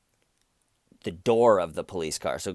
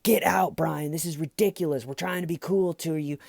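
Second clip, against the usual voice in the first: fed-up cop yelling; 8 semitones higher than usual. Retold or imitated. Police threatening the drunk driver with the taser, fed-up yell. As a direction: bark the order at last.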